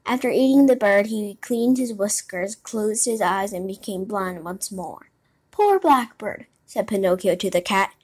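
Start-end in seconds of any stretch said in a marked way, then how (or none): 5.5-6.5 high, louder lament; lightly acted dismay, pitched up; pitying exclamation. A narrator's reading. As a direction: cry out in sympathy. Puppet pities the eaten bird.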